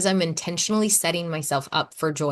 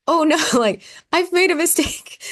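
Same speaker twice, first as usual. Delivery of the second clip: laughing, higher and louder mock alarm; mock panic; 11 semitones higher, 5 dB louder than usual. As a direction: play up the panic of realizing the mistake. Retold panic at game.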